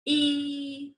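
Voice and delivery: E held long on one steady pitch; isolated sound. Shown as a demonstration. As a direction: stretch out E as a single separate sound. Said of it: "say it one sound at a time".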